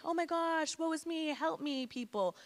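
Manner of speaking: high, whiny mock victim; mock pleading. Brat mocking the helpless victim role, high fake plea. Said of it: "Like, I wasn't like".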